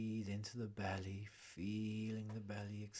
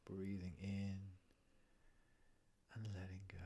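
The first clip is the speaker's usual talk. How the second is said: guided breathing meditation; 4 dB quieter than usual. Acted. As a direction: ease the listener into calm breath by breath.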